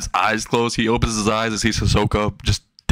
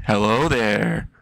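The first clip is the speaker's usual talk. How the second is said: creepy greeting; much slower than usual. Acted. Hisoka pops out of shadows.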